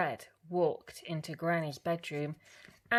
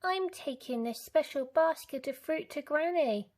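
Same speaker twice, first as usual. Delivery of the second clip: put-on little girl; 9 semitones higher than usual. A narrator's reading. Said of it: "Little Red replied".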